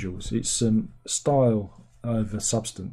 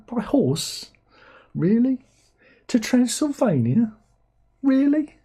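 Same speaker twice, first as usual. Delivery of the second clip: scoffing at a horse ride to Transylvania; incredulous; 12 semitones higher, slower than usual. Real, not acted. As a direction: climb higher with each disbelieving question. Mocking the plot's logic.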